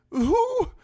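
high-pitched, loud lord's voice; startled highborn lord. A narrator's reading. Imprisoned lord turns his head to Davos, startled. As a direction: ask it sharply in a high old lord's voice.

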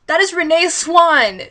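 slow, drawn-out excited reveal; excited realization. Real, not acted. Reader to viewers, excitedly recognizing the mother. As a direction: announce the discovery with delight.

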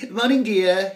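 hammed-up posh husband calling good morning; exclaiming posh father. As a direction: greet your wife with hearty gusto. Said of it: "he exclaimed".

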